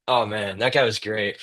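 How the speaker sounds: normal talking voice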